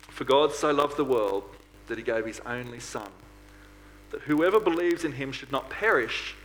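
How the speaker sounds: normal talking voice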